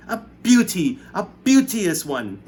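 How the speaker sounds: high, loud, slow gushing; Aunt Sponge cooing over the peach; delighted cry. A narrator's reading. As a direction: savour the word beauty. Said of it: "Aunt Sponge cried out".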